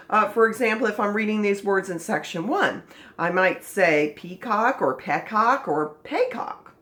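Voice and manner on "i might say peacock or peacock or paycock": "peacock" tried as pee-cock, peck-cock, pay-cock; trying vowel sounds. Shown as a demonstration. Teacher sounds out "peacock" three ways for class. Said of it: "try it out different ways".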